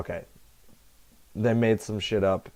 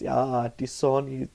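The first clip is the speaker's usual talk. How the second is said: put-on slow, higher German accent voice; exaggerated German accent, savoring gut; super German voice; 5 semitones higher, slower than usual. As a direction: drawl die Sonne in a hammed-up German accent. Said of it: "That's my super German voice".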